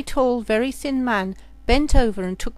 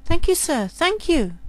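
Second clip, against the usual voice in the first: higher, louder grateful thanks; grateful girl; 7 semitones higher, 4 dB louder than usual. Acted. Girl thanking the short man for his seat, gushing. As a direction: gush your thanks.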